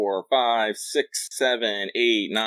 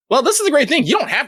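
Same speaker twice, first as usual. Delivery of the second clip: bursts in high and bright; eager, delighted answer; excited; 6 dB louder than usual. Real, not acted. Jumps in to answer reader.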